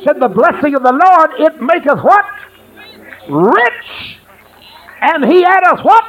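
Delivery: fiery revival preaching; full-force preaching. Acted. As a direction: lift the congregation up. Preaching call and response.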